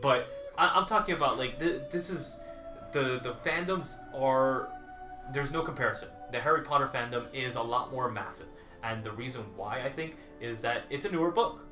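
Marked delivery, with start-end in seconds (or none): none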